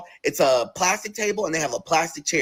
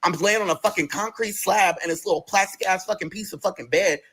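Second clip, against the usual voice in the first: ordinary delivery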